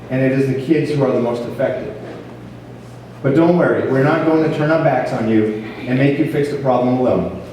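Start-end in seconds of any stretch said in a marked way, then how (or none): none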